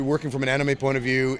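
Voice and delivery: ordinary delivery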